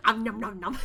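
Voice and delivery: playful eating noises through laughter; nom nom eating noises. Pretend. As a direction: chomp it out laughing. Mimics eating sounds while laughing.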